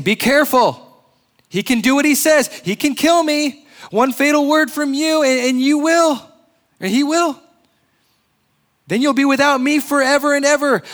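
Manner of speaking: high loud wheedling creature chatter; chattering lizard. Acted. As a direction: squeal it like a panicky little lizard begging. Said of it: "the lizard began chattering loudly".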